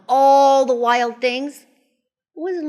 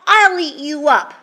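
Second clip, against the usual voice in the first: put-on loud, high child's threat; Max's retort; 6 semitones higher, 8 dB louder than usual. A narrator's reading. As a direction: throw it back loud and high. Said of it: "And Max said".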